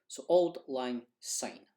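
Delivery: Auld Lang Syne said slowly with a broad Scots auld; Scots pronunciation. Shown as a demonstration. Teacher announcing the song title, slow Scots pronunciation. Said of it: "I'll pronounce the words for you".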